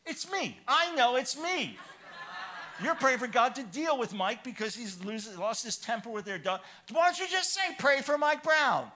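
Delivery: high, loud retelling of his angry protest; bursting out, wounded and accusing, voice jumping up; indignant outburst. Retold or imitated. Pastor retells snapping at the praying woman, high and loud. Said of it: "And I said".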